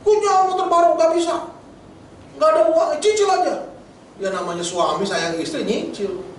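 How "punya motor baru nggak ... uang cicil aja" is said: high-pitched, hammed-up marital spat; mock angry wife. Wife quarrels with husband over a new motorbike, mock angry. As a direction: play the cross wife demanding, pitched well up. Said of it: "Si ibu marah".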